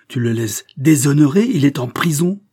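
loud, high reproach; crying out. A narrator's reading. Niece reproaching her aunt over Prosper in prison, crying out. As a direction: raise pitch and volume in indignation. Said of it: "s'écria-t-elle".

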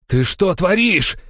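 high, slow horrified yell; shouted. A narrator's reading. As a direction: shout at a comrade in outrage. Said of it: "закричал он на другого дружинника". (возмущённо закричать)

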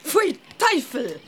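slow, drawn-out disgust. Acted. Mother tastes the foul water, disgusted. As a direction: spit out the taste.

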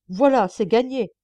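loud, bitter exclamation; exclaiming. A narrator's reading. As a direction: blurt it out loud in frustration. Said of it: "s'exclama le frère Lajoie".

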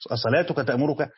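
ordinary talk

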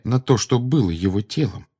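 ordinary delivery, nothing marked

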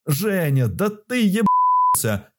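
high stunned shout of disbelief; изумлённый крик. A narrator's reading. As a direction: yell it in total shock. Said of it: "закричал я, пребывая в состоянии крайнего удивления". (изумлённый выкрик)

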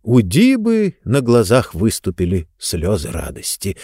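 ordinary delivery

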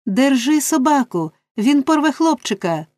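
high alarmed cry; frantic cry. A narrator's reading. Nancy begs Bill to hold back the dog, frantic. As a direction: plead urgently to save the boy. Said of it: "скрикнула Ненсі".

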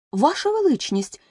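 high urgent call to the king; shouting out. Acted. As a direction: leap up and cry out to the king. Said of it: "крикнув білий кролик". (кролик гучно вигукує до короля)

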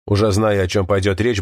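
ordinary delivery, nothing marked

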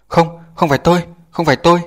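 high, panicked pleading; frightened denial. A narrator's reading. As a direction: beg them to believe you. Terrified boy denies blame.

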